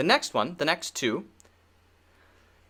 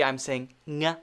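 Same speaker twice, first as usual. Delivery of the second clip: normal talking voice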